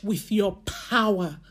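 pleading prayer with stretched pauses; fervent prayer. Acted. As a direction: pray it as an urgent plea for a refill.

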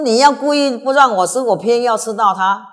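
loud, high, indignant; defiant outburst. Acted. Retainer defying the king, loud defiant outburst. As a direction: fling it back at the king in protest.

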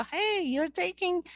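high-pitched teasing "hey"; joking call-out. Retold or imitated. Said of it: "we joke about it and go".

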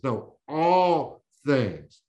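"all" drawn out slow and loud; stress on all. Shown as a demonstration. Preacher correcting "some things" to "all things", slow heavy stress.

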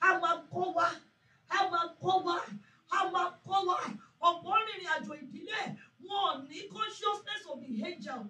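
loud emphatic preaching; preaching at full force. Acted. Pastor preaching on family angels, loud emphatic preaching. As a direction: drive each phrase home to the congregation.

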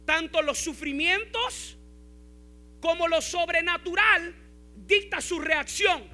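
loud, high, driving sermon delivery; full-force preaching. Acted. Pastor urging his congregation about Paul, preaching at full force.

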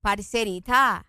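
slow, low copied Colombian lilt; drawn-out Colombian imitation; Colombian accent. Shown as a demonstration. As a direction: echo a Colombian accent, stretched out. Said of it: "hacelo vos y yo lo repito".

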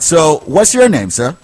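usual speaking voice

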